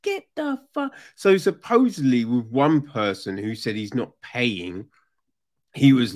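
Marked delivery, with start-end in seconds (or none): 0.0-0.9 high, fast, soft brush-off; imitating a dismissive victim; mock dismissal. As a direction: shoo them off in a high voice. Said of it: "they should have just been".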